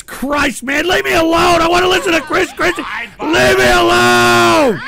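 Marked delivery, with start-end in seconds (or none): 0.9-2.8 high, loud outburst; angry shout. Real, not acted. Host yells at trolling chat. 3.2-4.8 high, loud, long-stretched yell; drawn-out bellow. Real, not acted. Host repeats it, bellowing.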